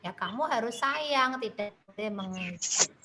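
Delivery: normal talking voice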